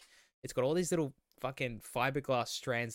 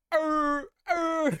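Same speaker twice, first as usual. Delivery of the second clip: high loud mock animal howl; 9 dB louder than usual. Imitating the moose in the wedding video, loud high howl. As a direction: throw your head back and howl. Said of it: "doing its fucking howling".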